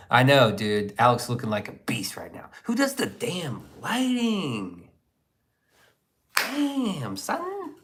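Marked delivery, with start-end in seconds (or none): 2.6-7.8 hyped. Real, not acted. Hyped reaction to photo.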